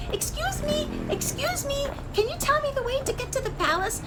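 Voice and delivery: very high, fast little voice; a tiny chick calling up at legs. A narrator's reading. Chick asking hurrying people the way, very high.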